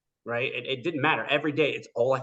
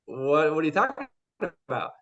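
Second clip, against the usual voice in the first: higher, louder baffled question; incredulous; 6 semitones higher, 7 dB louder than usual. Retold or imitated. Replying to a stranger's movie offer, incredulous. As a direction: act out disbelief at the offer.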